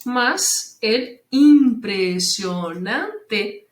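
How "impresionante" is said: slow im-pre-sio-nan-te; impresionante split in syllables. Shown as a demonstration. Teacher counting five syllables, impresionante syllable by syllable. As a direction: measure out each syllable of impresionante. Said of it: "com cinco sílabas".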